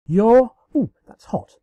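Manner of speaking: sudden surprise. A narrator's reading. Burned by hot cupcake.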